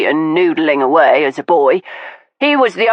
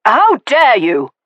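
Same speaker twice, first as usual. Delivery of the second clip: slow, higher, louder indignation; indignant outburst; 7 semitones higher, 3 dB louder than usual. Acted. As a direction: spit out the outrage. Caspian leaps up, fists balled.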